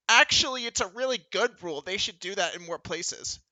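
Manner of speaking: high, loud, smug correction; smug know-it-all. Retold or imitated. Ike butting in on the floor man, smug and loud. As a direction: pipe up like a smug teacher's pet. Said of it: "And then Ike goes".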